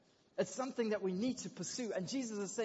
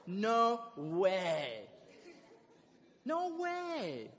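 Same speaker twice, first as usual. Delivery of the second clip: shocked disbelief; 3 dB louder, much slower than usual. Retold or imitated. Shocked reaction to being called evil.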